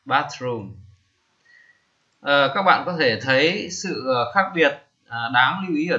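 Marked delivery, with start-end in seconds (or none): 0.0-0.8 Bathroom said slowly, each syllable clear, falling at the end; teacher's model word. Shown as a demonstration. Teacher repeats the word for the class, model pronunciation. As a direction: Bathroom read out once more as a model. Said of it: "tôi đọc là bathroom".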